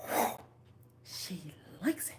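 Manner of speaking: unvoiced whispered aside; the universe whispering. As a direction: whisper it like a pleased cosmic voice. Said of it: "the universe goes".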